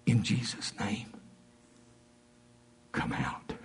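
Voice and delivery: hushed, secretive whisper; very soft whispered command. Retold or imitated. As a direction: whisper the command with long pauses. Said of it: "I whispered and I said".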